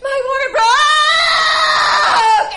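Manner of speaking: very high, loud slow shriek; a shrieking cry; screamed announcement. Retold or imitated. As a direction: wail it out. Announcing water broke.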